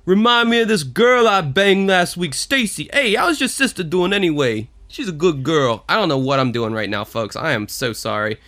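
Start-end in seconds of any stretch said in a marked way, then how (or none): 0.0-5.8 higher, swaggering New York tough guy; Rocky New York accent. Pretend. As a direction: keep hamming up the New York bruiser. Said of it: "You're trying to do Rocky?".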